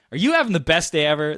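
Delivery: high and loud; eager greeting. Retold or imitated. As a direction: burst in on a stranger. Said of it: "if you come up to them".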